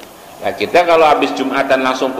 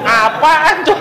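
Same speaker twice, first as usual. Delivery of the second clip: high-pitched mock bafflement; imitated baffled reaction; 12 semitones higher, much slower than usual. English teacher hearing formal Arabic, imitated high baffled reaction. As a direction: imitate someone thrown by unknown words, voice shooting up. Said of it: "Langsung dia begini".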